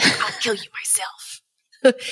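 whispered mock threat. Repeating the quote, whispered.

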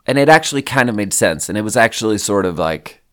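ordinary talk

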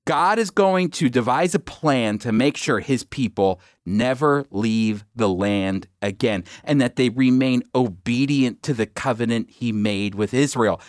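ordinary talk, nothing marked

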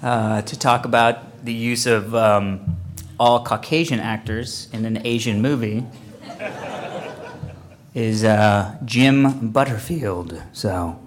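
loud, low announcer voice; host a con panel, booming; panel moderator voice. Acted.

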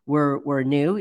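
ordinary talk

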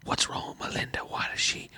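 hushed whisper back; whispered questioning. Acted. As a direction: ask under your breath, in a whisper. Player whispers back to whispering mayor.

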